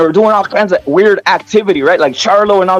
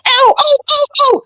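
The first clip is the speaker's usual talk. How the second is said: high-pitched mock yelps of pain. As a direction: squeal ow ow like a cartoon victim. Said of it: "And he's like".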